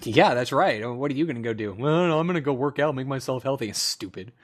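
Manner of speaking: normal talking voice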